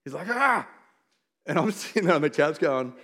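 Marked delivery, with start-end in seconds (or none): 0.3-0.7 imitating a child's frustrated "ah"; high, mock-exasperated outburst; frustrated yell. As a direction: cry out like a kid losing patience. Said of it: "he starts getting frustrated".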